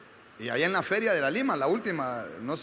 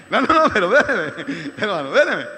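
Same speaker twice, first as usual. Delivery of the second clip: loud, laughing plea to wait; laughing interjection; 10 dB louder than usual. Real, not acted. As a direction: hold them off through real laughter. Breaks off the story, laughing. (pide que esperen, riendo fuerte)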